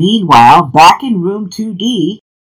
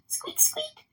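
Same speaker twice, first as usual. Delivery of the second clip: tiny, very high squeaks; toy squeak; 14 dB quieter than usual. A narrator's reading. Kids trying toys on the pet, tiny high squeaking.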